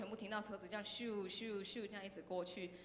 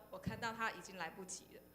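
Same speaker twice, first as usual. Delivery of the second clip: usual speaking voice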